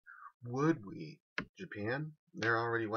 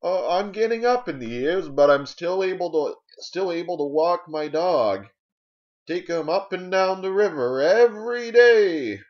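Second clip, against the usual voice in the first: high, loud old-timer impression; hammed-up elderly voice, stretched at the end; old person; 11 semitones higher, 12 dB louder than usual. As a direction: proudly holler it like an elderly dog owner. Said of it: "And they'll be like".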